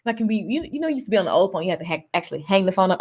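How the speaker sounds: ordinary talk, nothing marked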